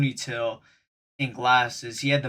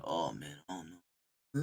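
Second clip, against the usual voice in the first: low, very soft mumble; feeble sick act; 8 semitones lower, 13 dB quieter, slower than usual. Retold or imitated. Worker acting sick as he told them, low and feeble. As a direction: put on a weak, drained voice, barely audible. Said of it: "in that tone".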